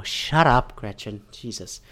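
a fed-up viewer grumbling at the TV; muttered aside. Real, not acted. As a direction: mutter it under your breath at the screen.

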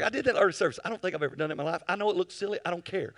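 normal talking voice

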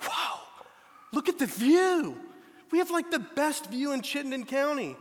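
amazed new homeowners admiring a mountain view; excited wonder. Retold or imitated. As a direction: gush over the view like newcomers. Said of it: "we were like".

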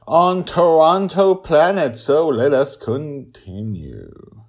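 slow, high, loud announcer read; grand announcement. Acted. Host introducing the story world, slow grand announcer read. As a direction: stretch it out like a trailer.

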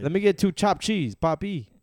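high, loud New York bodega order; New York accent. Pretend. Ordering at a New York deli, in a New York accent. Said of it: "New York?".

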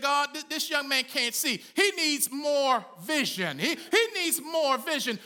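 a lightly voiced prophet praying; fervent prayer. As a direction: plead with God for the young man. Preacher voices Elisha's prayer.

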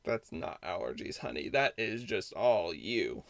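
low, soft, slow sass with a changed voice; sassy retort. Retold or imitated. Clerk's inner reply to the customer blaming allergies, sassy. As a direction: drop it down and drawl the put-down.

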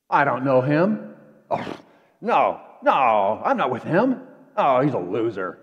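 a hint of a frightened Peter, slow and pushed; lightly acted Peter denying his friend; panicked denial. Peter denying Jesus to the crowd.

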